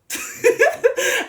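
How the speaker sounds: high, breathy real laugh; sudden high giggling burst; burst of laughter. Real, not acted. Speaker amused by her own remarks, high laugh.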